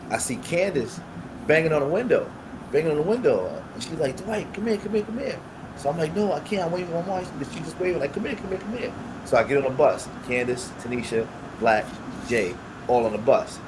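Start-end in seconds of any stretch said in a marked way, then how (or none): 4.2-5.4 high-pitched friend calling you over; imitated beckoning. Friend beckons from bus window. 6.1-7.2 high-pitched refusal; re-enacting a protest to a beckoning friend; retold protest. 8.1-8.9 high-pitched friend calling you over; imitated beckoning. Friend waving him over.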